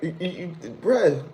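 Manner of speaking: ordinary delivery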